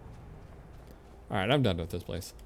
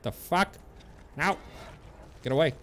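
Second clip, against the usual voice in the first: startled yelp; 9 semitones higher than usual. Real, not acted. Attacked by a giant.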